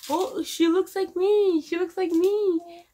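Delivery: overjoyed father, drawn out high; excited husband. Retold or imitated. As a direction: gush like a proud new dad. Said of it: "I hear my husband say".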